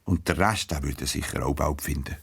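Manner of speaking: ordinary delivery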